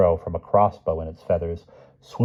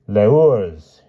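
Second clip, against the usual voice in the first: loud French cry; mild storybook scream, louder; screaming Hyde; 2 semitones higher, 6 dB louder than usual. A narrator's reading. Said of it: "Hyde screamed".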